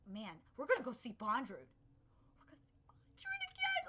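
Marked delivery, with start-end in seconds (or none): 2.4-3.8 high, breathy excited whisper. Real, not acted. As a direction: whisper it with eager glee. Excited about Bondrewd returning.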